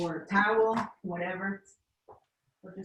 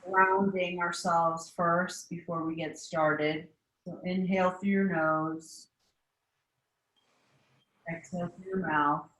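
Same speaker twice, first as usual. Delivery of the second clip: guided meditation. Acted. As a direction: guide the class through breathing, unhurried. Leads a seated meditation.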